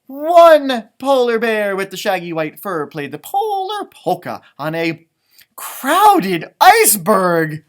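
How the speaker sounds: rhythmic read-aloud refrain, drawn out; sing-song story reading. A narrator's reading. Parent reading the refrain to children, sing-song. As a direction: read it like a picture book chant.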